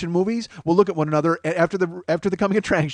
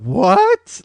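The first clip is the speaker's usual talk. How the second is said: rising, louder What as if to a moron; condescending what; 7 semitones higher, 5 dB louder than usual. Retold or imitated. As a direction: say What like she's an idiot. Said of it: "Like she was a fucking moron".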